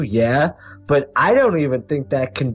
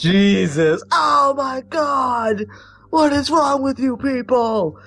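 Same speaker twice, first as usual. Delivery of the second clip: higher, slow disbelieving cry; exasperated, slow oh my God; shocked outburst; 9 semitones higher, slower than usual. Real, not acted. Shocked reaction to bee-sting death.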